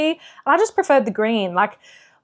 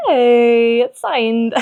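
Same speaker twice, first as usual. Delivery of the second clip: bright, loud little cheer; excited cheer; 2 semitones higher, 6 dB louder, much slower than usual. Retold or imitated. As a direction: cheer it like a happy find. Retold own cheer at bookstore.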